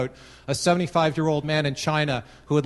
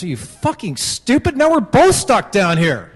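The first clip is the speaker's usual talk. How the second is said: much higher and louder, an incredulous angry yell, slowing on the second line; exasperated outburst; 6 semitones higher, 7 dB louder than usual. Acted. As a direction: blow up at the friend in disbelief. Angry reaction in joke.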